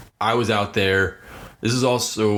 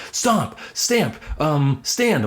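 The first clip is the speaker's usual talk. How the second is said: put-on higher, eager kid voice guessing words; 6 semitones higher, much slower than usual. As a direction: play a kid blurting out guesses. Kids guessing in charades.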